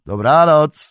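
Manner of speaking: loud, high farewell call; calling goodnight. Acted. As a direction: shout goodnight over the cart. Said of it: "zawołał". (głośne pożegnanie)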